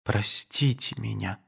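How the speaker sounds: pleading. A narrator's reading. Nadenka begs Aduev's forgiveness, soft and pleading. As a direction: beg his forgiveness softly. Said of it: "умоляющим голосом".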